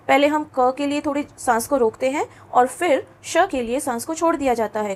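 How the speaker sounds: normal talking voice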